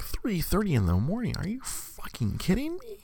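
mock outrage at being scheduled at three thirty; incredulous outburst. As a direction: pitch jumps high in disbelief, "morning?" rising. Hearing of a 3:30 shift.

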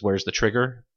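usual speaking voice

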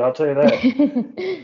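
laughing slowly through the words; chuckling, drawn out. Real, not acted. Teasing remark ending in laugh.

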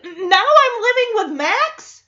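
high, loud incredulous question; imitating Jackson's outrage. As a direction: protest it in mock disbelief. Acting out Jackson's outraged reaction.

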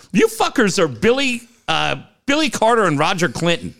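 ordinary delivery

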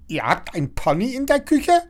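high, astonished neighbour calling out; nosy neighbour calls after the girl, high and astonished. A narrator's reading. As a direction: shriek it in disbelief. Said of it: "Frau Schnattermann rufen".

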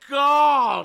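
loud, high cry of disgust; disgusted shout. Real, not acted. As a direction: yell out in revulsion. Reacting to disgusting story.

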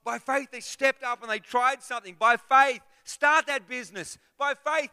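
high, loud driving sermon cry; preacher rousing the congregation; fiery preaching. Acted. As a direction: build and hammer each by faith. Preaching at full force.

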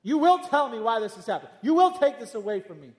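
defiant demand. Retold or imitated. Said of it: "demanding defiantly".

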